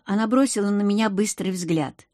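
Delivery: plain reading voice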